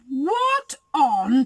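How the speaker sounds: loud, startled bear exclamation; alarmed Papa Bear. A narrator's reading. Papa Bear notices the locks, loud alarm. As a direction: exclaim it loudly, taken aback.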